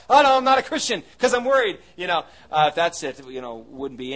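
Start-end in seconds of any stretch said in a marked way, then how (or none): 0.1-1.8 loud, high, hurried fretting; worried believer, played over the top; mock panic. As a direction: blurt it out in hammed-up alarm.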